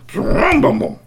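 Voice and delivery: loud, high garbled go at the name; the bear struggles to say the name; bear mangling name. Acted. Bear trying to say Strongbones, loud and garbled.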